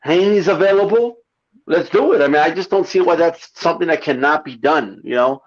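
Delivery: ordinary talk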